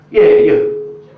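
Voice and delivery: single out a crowd member, voice jumping high; calling out. Real, not acted. Comedian picks out an audience member, calling loudly. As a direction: pitch leaps up, called across the room.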